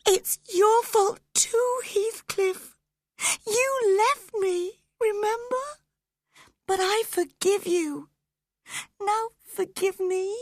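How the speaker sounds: high, loud, crying; tearful pleading. A narrator's reading. Dying Catherine begging Heathcliff's forgiveness, crying. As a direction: beg through tears.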